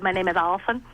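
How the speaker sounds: "Allison" with the s lisped as th; childhood lisp. Shown as a demonstration. Actress introduces herself as her little self, lisped "Allison". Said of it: "I had that lisp".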